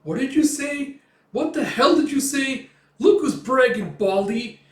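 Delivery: high, loud indignant outburst; shouting back high-pitched and furious; angry. Retold or imitated. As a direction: yell it in outraged disbelief. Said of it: "Puck's getting all angry".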